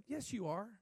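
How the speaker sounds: ordinary talk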